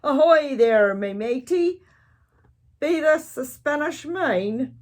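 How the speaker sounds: loud, high hammed-up pirate hail; booming pirate greeting; pirate yell. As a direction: bellow it across the beach like a pirate captain.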